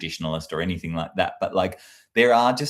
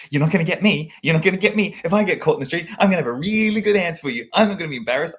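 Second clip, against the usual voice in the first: higher, louder, fired-up self-talk; pretend defiance; 9 semitones higher, 5 dB louder, faster than usual. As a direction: psych yourself up like a mock challenger. Rehearsing a gotcha-video comeback.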